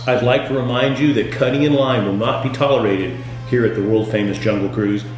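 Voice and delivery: usual speaking voice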